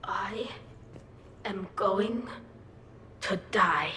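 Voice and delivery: hungover misery. Acted. Hungover guest the morning after the party, miserable. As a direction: drag each word out in hungover agony. Hungover crewmate groans complaint.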